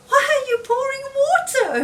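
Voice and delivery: a small frog calling out in surprise; high Frog voice. Acted. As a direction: pitch it way up for a puzzled Frog. Frog voiced in read-aloud story.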